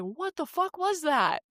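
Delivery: high, slow baffled disbelief. Real, not acted. Reacting to the hostile man.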